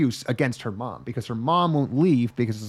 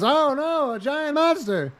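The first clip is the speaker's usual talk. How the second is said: mock panic; 11 semitones higher, slower than usual. Dad sees the giant monster.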